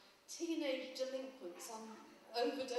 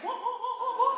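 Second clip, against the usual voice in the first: much higher and louder, confused repeated what; deaf old student; 12 semitones higher, 15 dB louder than usual. Retold or imitated. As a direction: shout it like a pensioner who can't hear. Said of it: "Somebody else going".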